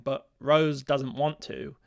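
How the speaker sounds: ordinary delivery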